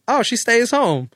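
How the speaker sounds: high, slow, sheepish voice; sheepish answer. Retold or imitated. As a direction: answer with awkward innocence. Imitating his own awkward answer.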